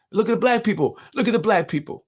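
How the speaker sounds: mocking imitation. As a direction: mimic the opponents' scorn in a high voice. Mocking what opponents think.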